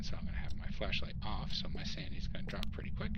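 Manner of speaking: hushed ghost hunt whisper. Real, not acted. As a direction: whisper so the ghost can't hear.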